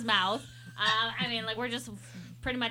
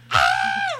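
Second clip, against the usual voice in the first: imitated scream; 8 dB louder than usual. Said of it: "like, screaming".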